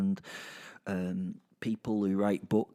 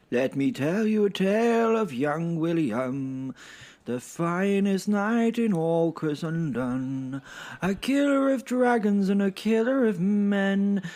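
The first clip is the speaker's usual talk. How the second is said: higher, slow, grand minstrel announcing a tale; boastful bard; 7 semitones higher, 4 dB louder, slower than usual. Acted. Percy introducing his friend William, grandly. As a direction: proclaim it like a showy court bard.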